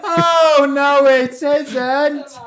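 high, slow panto shout; panto crowd callback. Pretend. Panto call and response.

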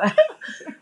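squealing laugh; high laugh. Real, not acted. Laughing at her story.

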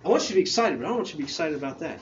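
gently correcting, a touch hurried; Jesus redirecting. Acted. Jesus to the excited disciples, softly redirecting. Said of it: "He's like".

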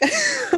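high burst of embarrassed laughter. Real, not acted. A woman laughs at her own awkward reply, cringing.

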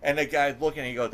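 ordinary talk

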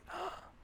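very quiet sharp intake of breath; retold gasp. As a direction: imitate a shocked, hushed gasp. Reenacts gasp at paused video.

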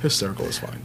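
muttered under the breath; hushed dismissive whisper; whispered aside. Retold or imitated. Band members dismissing the name.

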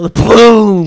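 loud high blast noise; mock shotgun boom. Clerk fires shotgun, loud mock boom. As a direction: shout the gunshot.

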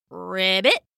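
a small frog's ribbit; cute cartoon frog croak; froggy croak.

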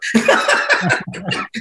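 high breathy laugh; breathy giggle at being stuck in a hotel room. Real, not acted. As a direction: let out a high airy laugh.

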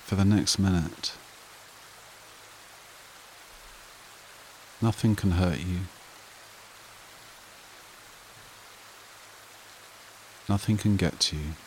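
soothing breathing-exercise delivery, spaced out and unrushed; guided meditation. Acted. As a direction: guide them gently into calm, phrase by unhurried phrase.

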